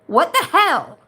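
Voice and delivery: sudden high, loud indignant cry; real exasperated shout; angry outburst. Real, not acted. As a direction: let the outrage burst out. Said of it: "'cause I'm gonna say some swear words here".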